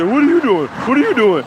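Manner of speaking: mocking busybodies. Mimics nosy people questioning him.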